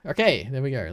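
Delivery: normal talking voice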